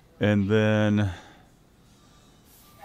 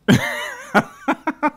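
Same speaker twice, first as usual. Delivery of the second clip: embarrassed laugh; 5 dB louder than usual. Real, not acted. Laughs at own retake.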